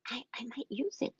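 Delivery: whisper. Real, not acted. Confessing plan shyly.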